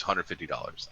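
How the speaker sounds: low, quiet flat price; understated mock vendor; deadpan dealer. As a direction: state the price like it's nothing. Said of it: "he goes".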